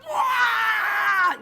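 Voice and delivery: high, loud exaggerated wail; mock outburst wail. Wife reacting to being told it's no big deal, mock wailing. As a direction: blow up in one long comic cry. Acted blow-up at being minimized.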